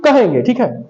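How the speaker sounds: ordinary talk, nothing marked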